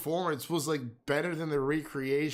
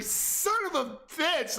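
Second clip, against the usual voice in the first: much higher, slow exasperated curse; retold outburst; 14 semitones higher, slower than usual. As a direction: drag out the swear in frustration. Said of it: "I was like".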